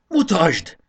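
loud, high one-word demand; high burst of excitement; excited shout. A narrator's reading. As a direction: shout it eagerly, unable to wait. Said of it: "kiáltotta izgatottan Módusz".

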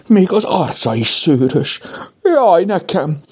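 high-pitched frightened wail; stifled shriek of fear. A narrator's reading. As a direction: panic held back, pitch leaping up. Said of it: "folytotta tenyerébe sikítását Kajtánka".

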